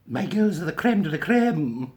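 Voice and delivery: high, slow, drawn-out Scottish schoolmistress impression; Miss Jean Brodie. Teacher boasting of her girls, slow high impression. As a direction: play the grand Scottish teacher, stretching it out. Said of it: "in the prime of Miss Jean Brodie".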